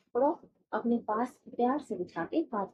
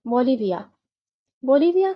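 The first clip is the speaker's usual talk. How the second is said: teacher's announcement; 3 semitones higher, 10 dB louder than usual. Shown as a demonstration. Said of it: "teacher announcing next country loudly".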